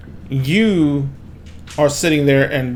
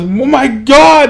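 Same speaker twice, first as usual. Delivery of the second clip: high, drawn-out cry of panic; freaking out; 10 semitones higher, 4 dB louder, slower than usual. Acted. Game master voices crowd seeing the gore, freaking out. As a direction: stretch it out, pitched way up. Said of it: "they start freaking out".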